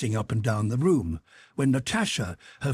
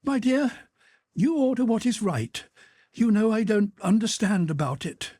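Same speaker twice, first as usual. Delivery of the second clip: shamefaced wife; 11 semitones higher than usual. Acted. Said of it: "dropping her eyes shamefacedly".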